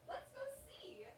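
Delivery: hushed whisper to the dog; excited whisper. Real, not acted. To the dog at the door, an excited whisper. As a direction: whisper it like a secret to the pet.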